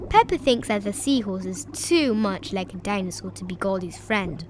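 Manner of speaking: ordinary delivery, nothing marked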